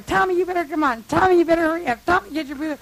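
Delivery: high, loud re-enacted urging; retold hollering. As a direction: holler at him to get moving. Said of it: "I'm hollering".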